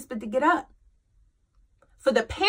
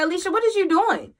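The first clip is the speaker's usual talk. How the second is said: quick, high-pitched reproach; incredulous self-scolding; 7 semitones higher, faster than usual. Retold or imitated. Mom talking to herself in her mind, incredulous. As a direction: call yourself out in disbelief, pitched up. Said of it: "in my mind, it was just like".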